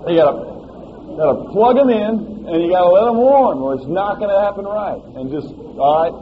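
loud, impassioned preaching; preaching at full force. Acted. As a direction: drive it home to the congregation.

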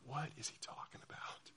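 whispered aside to a neighbor; confused whisper. Retold or imitated. Student whispering to friend during the sermon, hushed. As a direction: whisper it like chatting in the pew. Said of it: "you're like".

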